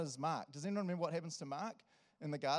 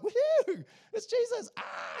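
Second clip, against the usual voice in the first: much higher and much louder, thrilled yell; overjoyed shout; 11 dB louder, slower than usual. Acted. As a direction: leap for joy shouting. Peter sees Jesus, jumps out.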